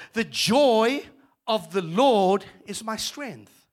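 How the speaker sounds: scripture proclaimed loud and slow; preaching. Acted. Preacher proclaiming scripture to the congregation, loud. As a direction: declare it with full force.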